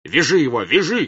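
loud, high, urgent command; furious command to seize him; shouted order. A narrator's reading. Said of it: "кричал он".

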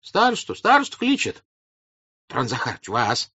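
high anxious calls, dropping at the end; meek, hasty relay; hurried submissive voices. A narrator's reading. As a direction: pass the summons on obediently. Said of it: "послышались кое-где торопливо покорные голоса".

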